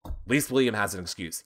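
ordinary delivery

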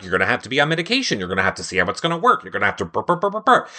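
fast, rushed doctor's list; rattling doctor. Retold or imitated. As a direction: rattle off orders like a hurried doctor. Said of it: "Doctor was like".

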